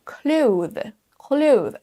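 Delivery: CLOTHES with full TH then Z, drawn out; TH plus Z. Shown as a demonstration. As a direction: articulate both TH and Z ending CLOTHES. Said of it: "Un son T H et ensuite". (CLOTHES avec TH puis Z)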